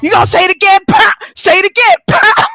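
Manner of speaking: high, loud mocking taunt; taunting shout. Woman taunting the one on the floor, high loud jeer. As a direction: jeer at her while she's down. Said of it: "She was like".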